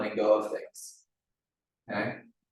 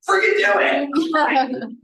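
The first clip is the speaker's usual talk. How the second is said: urging shout; 8 dB louder, much slower than usual. Retold or imitated. Urging her sister to cry.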